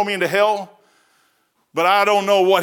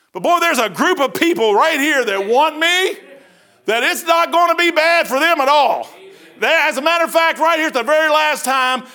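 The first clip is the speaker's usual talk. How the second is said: raised-pitch, forceful sermon delivery; impassioned preaching; 7 semitones higher, 4 dB louder, slower than usual. Real, not acted. Preacher warning the congregation about coming troubles, impassioned preaching. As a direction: drive each phrase home from the pulpit.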